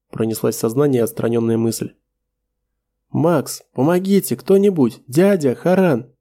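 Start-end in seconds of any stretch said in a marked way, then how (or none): 3.1-6.1 screaming for help. Acted. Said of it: "Марагра сорвалась на крик".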